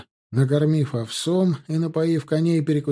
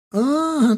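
high piercing scream; thin shriek; 12 semitones higher, 4 dB louder than usual. A narrator's reading. Woman shrieking in terror at her rescuers. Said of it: "тонко завизжала баба".

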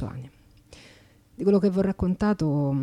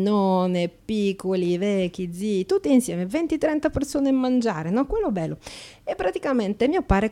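chatty Slovak immigrant in broken Italian, brightly pitched up; a cheeky Slovak accent, lively and a little higher; 6 semitones higher, 5 dB louder than usual. Acted. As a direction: play an Eastern European woman mangling Italian grammar with cheerful confidence. Actress plays Slovak immigrant.

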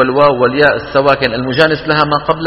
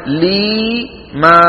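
لي and ما each held long and evenly; slow measured demo of لي ما; equal long vowels; 4 semitones higher, much slower than usual. Shown as a demonstration. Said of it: "واللفظ في نظيره كمثله". (مد متساوٍ في لي ما)

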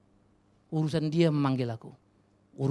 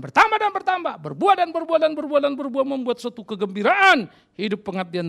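loud, much higher, rising exhortation; rally the church, building higher on each repeat; fiery preaching; 12 semitones higher, 10 dB louder than usual. Acted. Pastor rousing the congregation to bear fruit, loud and high.

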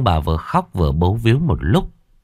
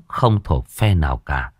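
ordinary delivery, nothing marked

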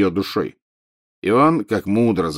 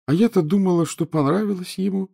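put-on high, whining ghost-girl voice; plaintive ghost girl; 11 semitones higher than usual. A narrator's reading. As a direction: complain like a hurt schoolgirl. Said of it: "жалобно продолжала Миртл".

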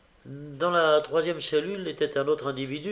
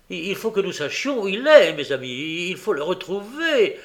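higher, louder, stammering agitation; with emotion; 6 semitones higher, 6 dB louder than usual. A narrator's reading. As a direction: urge them on, shaken. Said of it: "dit le juif avec émotion".

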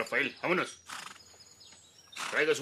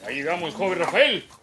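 high holler, louder on the name; calling out; 6 semitones higher, 5 dB louder than usual. Acted. Driver tells young Rafael they have arrived, hollering. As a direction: announce the arrival in a high outdoor call.

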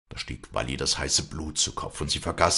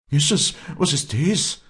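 high, louder startled cry; frightened cry; 11 semitones higher, 5 dB louder than usual. A narrator's reading. Afra startled as the vulture rushes in, alarmed cry. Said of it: "schrie Afra".

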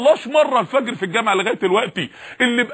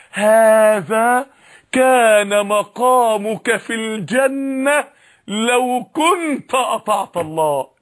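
solemn voice of judgement; much slower than usual. Acted. Told to the sinner in his grave, slow solemn verdict. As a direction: pronounce it like a final sentence from above. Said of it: "ثم يقال له".

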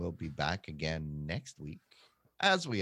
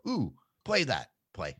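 eager excited; 3 semitones higher, 4 dB louder than usual. Retold or imitated. Imitating eager user.

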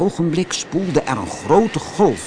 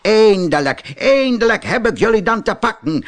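a higher, gloating farmer voice; triumphant farmer; 9 semitones higher, 5 dB louder than usual. A narrator's reading. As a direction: crow over catching the thieves at last. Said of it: "zei de boer".

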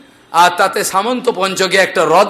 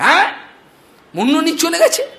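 shocked disbelief; 8 semitones higher, faster than usual. Retold or imitated. Son shocked.

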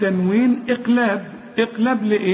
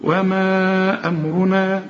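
slow, sustained recitation with long vowels; joined up slowly; much slower than usual. Shown as a demonstration. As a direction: join the halves and hold the final vowel. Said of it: "وبعدين يبقى الحمها".

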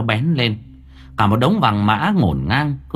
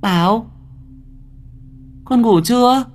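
put-on high, searching call to a child; mother calling out; 13 semitones higher than usual. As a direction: call into the dark for your son. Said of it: "Cô gọi".

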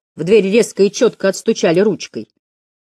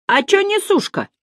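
high-pitched heckle from the back row; shouted heckle. A narrator's reading. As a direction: call out cheekily, voice pitched way up. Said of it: "крикнули с задних парт".

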